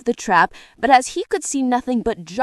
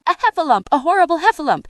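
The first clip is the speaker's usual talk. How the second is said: high-pitched panicked exclamation; terrified little creature; frightened cry; 6 semitones higher, 4 dB louder, much faster than usual. A narrator's reading. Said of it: "cried Piglet".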